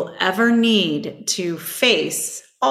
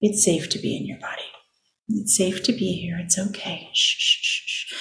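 soothing shush. Shown as a demonstration. Shushing her anxious inner voice, whispered and soothing. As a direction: hush a frightened child to calm. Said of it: "I shush it and I just".

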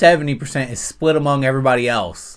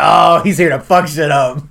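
loud, raised, thrilled exclamation; excited realization; 5 semitones higher, 10 dB louder than usual. Retold or imitated. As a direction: re-enact spotting trouble coming. Reenacting realisation while watching.

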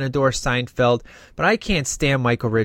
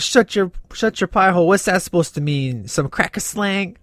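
higher-pitched mock-angry retort; Marcus; 4 semitones higher than usual. Fan re-enacting a movie character throwing Rex's insult back, mock angry. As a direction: imitate an indignant, incredulous comeback. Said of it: "he's just so angry".

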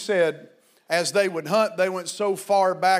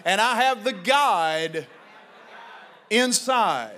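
slow call-and-response line; 4 semitones higher, slower than usual. Shown as a demonstration. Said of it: "say it with me".

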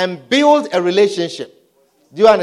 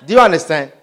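ordinary talk

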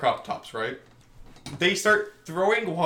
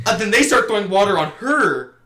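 ordinary talk

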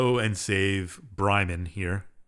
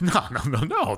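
mock-innocent denial, pitch jumping up; hasty denial; 5 semitones higher than usual. Player character denying to Bishop that he looked, hasty denial. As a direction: deny it too quickly, going higher.

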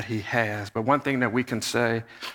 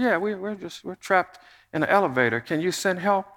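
calm lady; 7 semitones higher than usual. Retold or imitated. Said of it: "She said".

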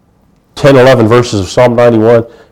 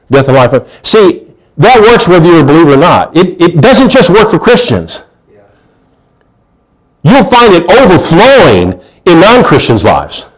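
normal talking voice

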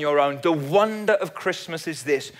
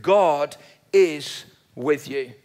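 tender assurance; 4 semitones lower, much slower than usual. Real, not acted. Preacher reassures grieving listeners, slow and tender. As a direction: say it slowly as a gentle promise.

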